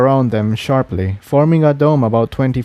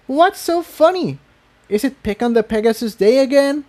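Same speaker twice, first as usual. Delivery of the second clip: put-on high, hurt and indignant young mare; turning angry; 12 semitones higher than usual. A narrator's reading. Tiptoe confronting Jigsaw over his smile, hurt anger. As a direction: snap back at being laughed at. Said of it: "fear quickly turned to anger".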